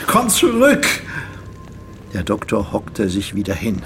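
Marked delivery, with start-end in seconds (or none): 0.1-0.9 high, loud cry after her; anguished high shout; desperate calling out. Acted. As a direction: call her back desperately. Calling after the vanished woman.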